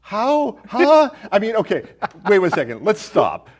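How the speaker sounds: ordinary talk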